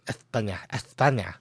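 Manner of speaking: España with a lisped th; España said as Ethpaña; Spanish pronunciation. Shown as a demonstration. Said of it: "We're Spanish".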